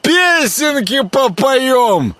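put-on high, slow, slurred drunken glee; barely able to move his tongue; drunk slurring. A narrator's reading. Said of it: "едва ворочал языком Грехвост".

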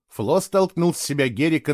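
plain reading voice

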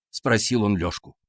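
plain reading voice

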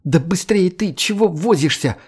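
high, pressing and impatient; impatient hurrying. Acted. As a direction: hurry him up, fed up. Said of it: "поторопила Макс Анфиса".

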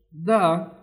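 thin, high whistling alien voice; short, thin and whistly. A narrator's reading. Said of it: "тоненьким, свистящим голосом".

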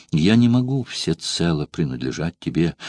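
plain reading voice

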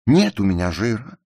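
high, loud wounded cry; tearful outcry. A narrator's reading. Ruth denies the narrator's insult about fat, tearful outcry. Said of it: "со слезами воскликнула она".

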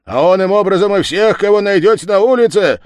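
high, loud commanding proclamation; village head's decree. Acted. Village head orders arrests, loud and high. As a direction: lay down the order forcefully. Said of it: "сказал голова".

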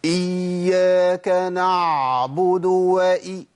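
إياك نعبد with the ع stretched long and loud, slow; mock a reciter dragging out the ع; تقليد جريان صوت العين زيادة; imitating over-flowing ain. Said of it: "ناس بتمشي الصوت زيادة".

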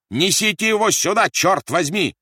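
very high, loud yell; angry shout. A narrator's reading. Officer yells at his men for the baby, angry shout. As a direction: shout an impatient order. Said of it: "закричал Рольф".

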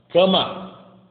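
loud one-word order; fed-up shout. Retold or imitated. A tired adult yelling at a child to go to bed. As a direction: bark it at the child. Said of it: "Na terceira, você já tá".

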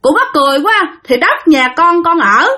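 put-on father scoffing at the old man and sending him off; mocking scorn. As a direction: laugh him off and shoo him away. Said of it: "cái ba nói rằng".

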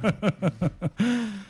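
a high, amused laugh. Real, not acted. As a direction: laugh off the jab. Laughs at the insult.

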